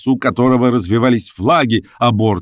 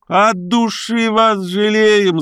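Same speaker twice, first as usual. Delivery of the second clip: much higher, slow mocking cry; women's shout; 12 semitones higher, much slower than usual. A narrator's reading. Masked women shout to the boat, mocking. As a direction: call out pity in jest. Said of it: "закричали женщины".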